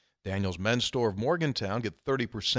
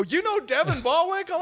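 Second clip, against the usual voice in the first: high, delighted disbelief; high surprise at the name; amazed; 3 dB louder than usual. Retold or imitated. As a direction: play the stranger's amazement. Couple's amazement, retold.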